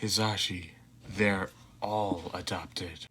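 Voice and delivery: a low, slow, deadpan mutter. Acted. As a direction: mumble it half asleep. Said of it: "Shota muttered".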